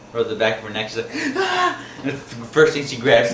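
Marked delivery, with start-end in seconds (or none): 1.4-1.8 very high imitated shriek; mock startled scream. Wife feels a swatter on her neck, imitated high shriek. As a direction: shriek like you've been jumped from behind.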